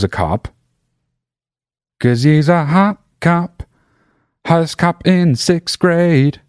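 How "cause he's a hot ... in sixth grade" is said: high, drawn-out hot-cop hype; mock hype. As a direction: play up the hot cop in a raised voice. Mock hype about classmate as cop.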